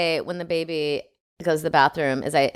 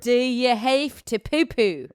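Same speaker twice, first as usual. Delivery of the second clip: slow, higher Australian question; Australian accent; 5 semitones higher, slower than usual. Shown as a demonstration. Repeating the baby question after praise, Australian accent. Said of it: "Way better".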